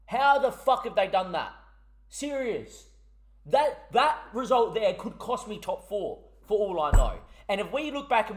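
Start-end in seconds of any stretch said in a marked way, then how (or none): 0.1-5.6 angry outburst. Real, not acted. Raging after conceding late goal.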